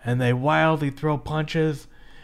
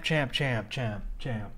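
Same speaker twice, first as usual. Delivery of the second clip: fading echo; 5 semitones lower, 7 dB quieter, slower than usual. Pretend. Echo of the word champ, fading.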